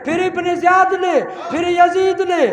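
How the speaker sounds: very high, very loud shouted oratory; fiery climax preaching. Acted. Preacher naming Husain's killers to mourners, shouting. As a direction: rage at the killers at the top of your voice.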